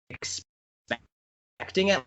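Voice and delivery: normal talking voice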